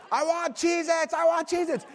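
high mock-tantrum chant; imitated screaming. Dad re-enacting his son's tantrum, high imitated scream. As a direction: stretch it out like a screaming little kid. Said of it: "he's just screaming".

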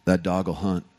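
lightly voiced Texan drawl, pitched low; a hint of a Texan saying. Pastor imitating Texans with a low Texan drawl. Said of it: "they'll say this phrase right here".

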